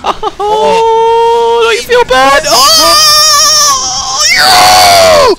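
high, loud drawn-out whooping; gloating howl. Real, not acted. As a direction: howl it in mock pity and triumph. Gloating, howling in triumph over teammate.

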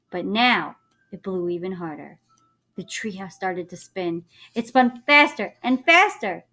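ordinary talk

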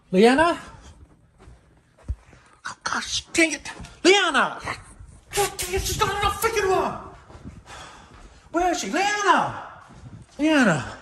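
furious parent shouting down the hall; frantic angry calling. Acted. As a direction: yell her name across the house, exasperated. Dad hunting for misbehaving kid.

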